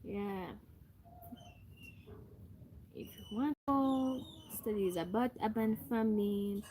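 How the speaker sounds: ordinary delivery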